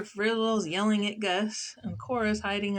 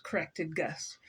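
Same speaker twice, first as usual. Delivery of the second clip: plain reading voice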